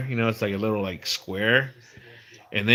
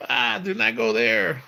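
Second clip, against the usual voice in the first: high, drawn-out mock dread; mock warning; 8 semitones higher, slower than usual. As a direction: pretend to recoil from a forbidden house. Imagined warning about his house.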